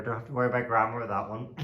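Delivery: ordinary delivery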